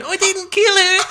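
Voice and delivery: high, strained mock South African plea; hammed-up South African courtroom voice; mock Oscar Pistorius. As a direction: copy a tearful courtroom denial in a South African accent. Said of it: "attempt at copying Oscar Pistorius' voice".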